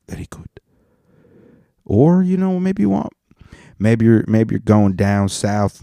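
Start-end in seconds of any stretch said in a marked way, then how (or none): 0.1-0.5 whispered very good. Real, not acted. Savouring imagined pizza, whispered. 1.9-3.1 much higher sudden squeak; squeaky high voice. Pretend. Pitching a new pizza idea, squeaky high.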